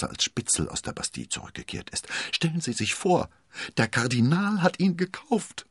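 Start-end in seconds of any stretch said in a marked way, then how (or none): 2.3-5.7 indignant. A narrator's reading. Constanze outraged about her husband.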